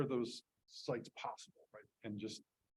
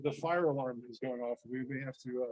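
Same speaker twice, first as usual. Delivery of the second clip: shouting over fire alarm; 7 dB louder than usual. Real, not acted.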